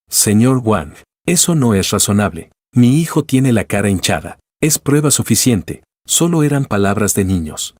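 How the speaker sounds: ordinary delivery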